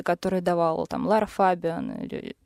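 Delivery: ordinary talk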